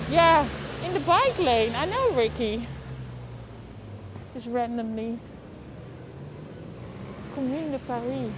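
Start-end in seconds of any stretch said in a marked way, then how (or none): none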